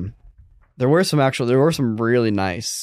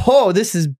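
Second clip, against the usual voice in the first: mock excited; 8 semitones higher, slower than usual. Recalling excitement at first cameras.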